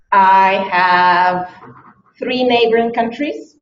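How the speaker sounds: ordinary talk, nothing marked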